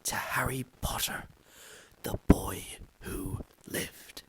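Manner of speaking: slow, breathy reverent whisper; hushed voices. A narrator's reading. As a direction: whisper the toast with awe. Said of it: "saying in hushed voices".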